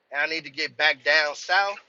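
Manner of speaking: ordinary talk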